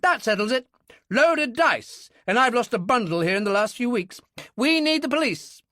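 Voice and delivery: high and loud, righteous outrage; officer declaring the verdict; angry military man. Acted. Military man demands police.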